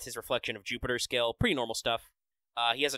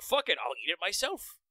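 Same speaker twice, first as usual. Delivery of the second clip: mock exasperated outburst, swooping higher; lightly voiced Dagon; 6 semitones higher than usual. Dagon deciding to do it himself, mock exasperated. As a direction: lightly act out a god giving up on the plan. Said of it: "Dagon's just like".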